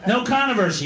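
usual speaking voice

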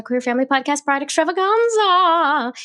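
Extravaganza flung up high, then dropped; signature show-name call. Shown as a demonstration. Host introducing guests' pitch, showy high show-name call. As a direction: announce the Pride Extravaganza with a flourish. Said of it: "I have to say it that way every time".

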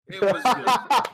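laughing, slow and loud. Real, not acted. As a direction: say it through a laugh. Laughing, emphatic recommendation.

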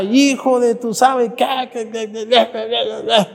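high, shrill-free mock road rage outburst; mock angry driver cursing. Priest mimicking a driver cursing at traffic, mock angry. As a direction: spit out a half-censored curse at another car.

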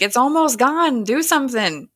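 lightly hammed-up plea; retold urgent plea. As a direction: imitate a kid urging someone to act. Retold panicked plea of a boy.

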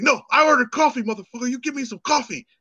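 high, insistent mock customer rant; retold angry screaming. Drunk customer arguing with the cashier, retold angry yelling. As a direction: imitate an irate drunk insisting on his order. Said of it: "he starts screaming at me".

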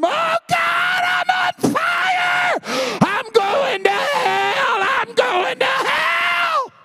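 very high, slow, loud shrieks; dying man shrieking that he is on fire; screaming in terror. Retold or imitated. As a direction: wail as if burning alive. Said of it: "he began to scream".

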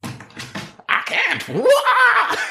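very high, squeaky unhinged wail; a manic stranger yelping at Jeff; crazy person. Acted. Co-host playing the crazy man on the train, squeaky high yelp.

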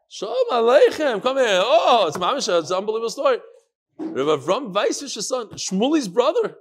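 loud, high, delighted welcome; excited greeting. Real, not acted. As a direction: hail an unexpected guest with real excitement. Greets a visitor walking in mid-class.

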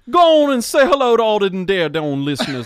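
a country host egging on a guest. Acted. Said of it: "really, really hick".

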